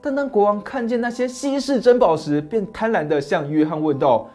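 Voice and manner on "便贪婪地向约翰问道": ordinary delivery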